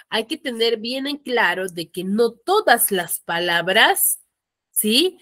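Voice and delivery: ordinary talk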